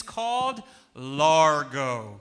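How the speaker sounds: slowly, loudly stretched out; deliberately slow teacher's delivery; slow and emphatic. Shown as a demonstration. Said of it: "let's start with a slow one".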